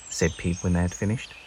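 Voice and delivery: plain reading voice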